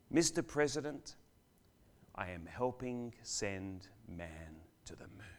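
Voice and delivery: soft, measured, near-whispered reply; twinkle in the eye. A narrator's reading. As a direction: let the cleaner's answer sink to a hush. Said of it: "with a twinkle in his eye".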